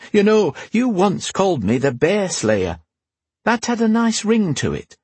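high, playful queen's voice; teasing queen. Acted. Queen reminding knight of her old title, teasing. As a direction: reminisce in a lifted, needling voice.

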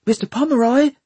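high-pitched, held-back calling; high, hushed hail; soft urgent call. A narrator's reading. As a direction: call out without raising the alarm. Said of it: "calling softly".

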